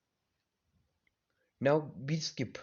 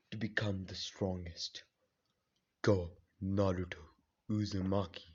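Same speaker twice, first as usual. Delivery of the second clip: low solemn spirit voice; 4 semitones lower than usual. Acted. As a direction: intone it like an ancient power passing on its gift. Mysterious figure grants power.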